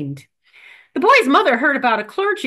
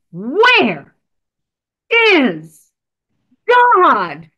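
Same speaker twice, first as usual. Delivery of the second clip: booming final demand; 5 dB louder, much slower than usual. Acted. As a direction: lean in and hammer the last time. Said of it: "for the final time, the minister leaned in".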